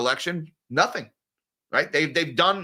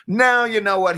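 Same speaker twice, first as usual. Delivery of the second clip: sudden turnaround; 6 semitones higher, 5 dB louder than usual. Real, not acted. Reversing the mock sympathy.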